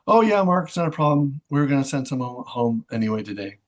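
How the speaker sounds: lightly voiced manager saying it's no problem; cheery manager voice. Manager Missy voiced answering cheerfully.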